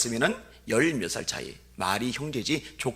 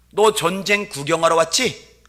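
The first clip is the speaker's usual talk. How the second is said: needling taunt; 5 semitones higher, 9 dB louder, slower than usual. Retold or imitated. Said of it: "염장 지른 소리".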